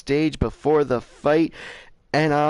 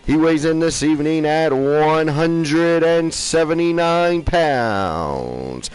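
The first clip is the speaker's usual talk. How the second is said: ring announcer; 4 dB louder, much slower than usual. Acted. Announcer introducing the defending champion, soaring ring announcer voice. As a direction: belt out the champion's titles and name, very drawn out.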